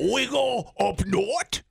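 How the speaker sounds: high, slow mock Frenchman groping for English; French accent. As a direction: say it haltingly as a French tourist. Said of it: "You say, how do you say".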